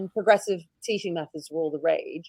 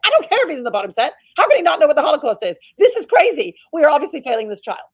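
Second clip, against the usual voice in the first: higher, louder, fast indignant retort; a teacher arguing with her principal, louder and indignant; indignant protest; 6 semitones higher, 7 dB louder, faster than usual. Retold or imitated. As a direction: insist hotly that the school is failing him. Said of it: "And I'd say".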